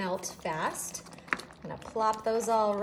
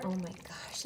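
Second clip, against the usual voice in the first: whispering; 4 semitones lower, 9 dB quieter than usual. Real, not acted. Smelling the food cooking.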